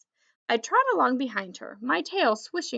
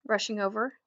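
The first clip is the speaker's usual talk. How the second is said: plain reading voice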